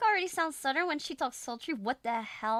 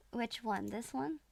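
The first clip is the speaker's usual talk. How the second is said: ordinary talk, nothing marked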